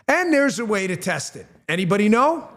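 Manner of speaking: ordinary talk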